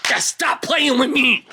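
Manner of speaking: high-pitched, drawn-out hype yell; over-hyped shout. Pretend. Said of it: "this is too much hype".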